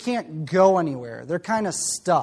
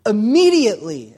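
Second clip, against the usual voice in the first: IMMEDIATELY punched loud, as the key word; stress on immediately; 3 semitones higher, 7 dB louder than usual. Shown as a demonstration. Preacher to congregation, stressing Immediately loudly. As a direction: hit the word Immediately hard. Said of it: "this is the key word".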